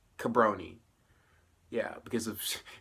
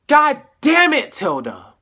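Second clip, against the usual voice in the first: much louder and higher curse at the screen; furious shout; 13 semitones higher, 14 dB louder than usual. Real, not acted. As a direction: yell at the character in frustration. Reacting to Alex's death.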